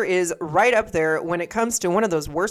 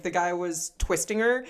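usual speaking voice

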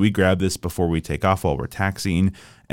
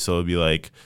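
usual speaking voice

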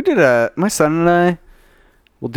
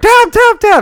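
mock panicked; 8 dB louder than usual. Retold tapping out under mount.

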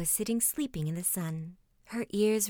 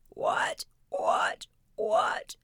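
croaked word like a frog; much slower than usual. A narrator's reading. Frog beside the hat on the shore, frog croak. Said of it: "a frog sat down beside it and croaked".

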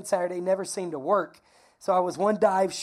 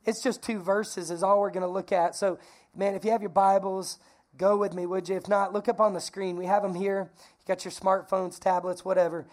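ordinary talk

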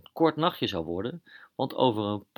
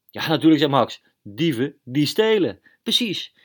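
Max voice; 4 semitones higher, 6 dB louder than usual. Acted. Max agrees, voiced character.

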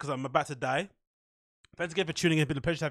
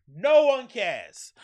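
sarcastic drawl; 6 semitones higher, 5 dB louder, much slower than usual. Real, not acted. Sarcastic aside on his post.

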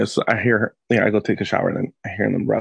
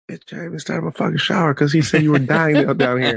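higher-pitched, comic dad outrage; mock exasperated rant; 5 semitones higher than usual. Dad to kid after interrupted shower, mock exasperation.

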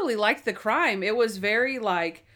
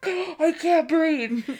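slow, high, gasping I can't breathe; hammed-up gasp as he meets the stench; gasping panic; 8 semitones higher, 4 dB louder, much slower than usual. Monk gags at the crime scene, slow high gasp. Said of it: "He's like, gasps".

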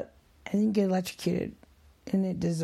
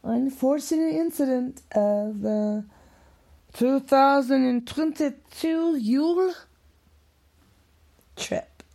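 higher, louder, slow title read; hammed-up announcer naming the incident; mock announcer; 6 semitones higher, 4 dB louder, slower than usual. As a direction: announce it like a grand event.

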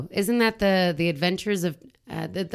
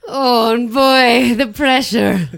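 hammy strained voice; 5 semitones higher, 9 dB louder, much slower than usual. Pretend. Joking about pressure, hammed up.

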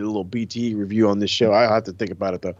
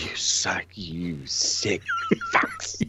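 slow mock whisper breaking into a laugh; disgusted whisper; 4 semitones lower, 5 dB quieter, much slower than usual. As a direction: hiss the insult under your breath, then crack up. Joining the disgusted whisper bit.

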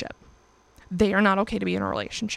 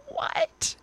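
mock surprise; 6 dB quieter than usual. Mock disbelief aside.

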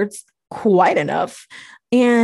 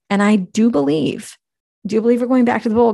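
ordinary talk, nothing marked

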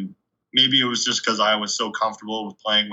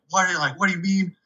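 high-pitched, exasperated questioning, not really mad; retold grilling, pitched up without shouting; 7 semitones higher than usual. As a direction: act out a coach grilling a player. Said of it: "just grilling me".

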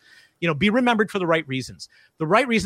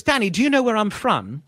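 higher, lilting British question; British director's accent; 4 semitones higher than usual. Retold or imitated. Director quizzing child actor, imitated British accent. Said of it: "he says to me".

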